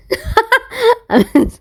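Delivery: sudden high squeal of a laugh. Real, not acted. Laughing at herself after scolding, a high giggle.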